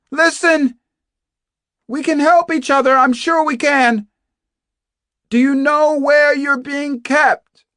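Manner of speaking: high, loud, urgent shout; Kate McTiernan calling out. Acted. Captive urging a hidden fellow prisoner to team up, shouting. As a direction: call out hard to reach someone behind walls. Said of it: "Kate McTiernan called out again".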